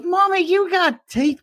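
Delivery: very high, slow mock child voice; British little girl. Little girl to her mommy, high mock-British child voice. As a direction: squeal it like a small British girl. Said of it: "the little girl goes".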